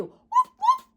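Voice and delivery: very high, loud little yips; puppy barking. Acted. Teacher voicing the puppy in a story, high puppy bark. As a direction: yip like a tiny puppy.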